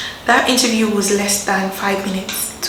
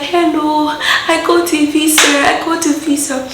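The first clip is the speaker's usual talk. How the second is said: higher, bright and eager; excited news; 7 semitones higher than usual. Retold or imitated. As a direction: tell your sister the good news. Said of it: "I was like".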